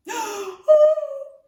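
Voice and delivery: high, loud cry of protest; wailing child. Acted. Daughter horrified by the dragon's plan, wailing. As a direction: wail it like an upset little girl.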